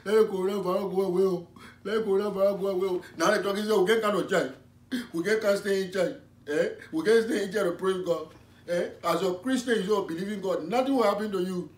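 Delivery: put-on loud, fervent West African preacher accent; comic West African accented preaching; West African pastor.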